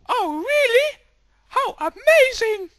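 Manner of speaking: very high, loud, amazed wife. Acted. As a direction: squeal with delight at the news. Wife reacts to gossip.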